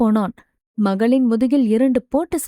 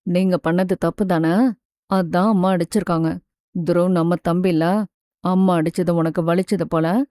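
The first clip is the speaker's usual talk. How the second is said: patient father; 5 semitones lower than usual. Acted. Father gently explains to his daughter why hitting is wrong, low patient voice. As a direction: lower your voice and reason kindly with a child.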